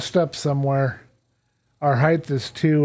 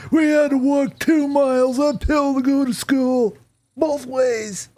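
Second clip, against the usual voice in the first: put-on old man voice; 10 semitones higher than usual. Minecraft player doing an old man lecturing kids. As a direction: grumble at the young whippersnappers like a grandpa. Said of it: "This is my best impression voice".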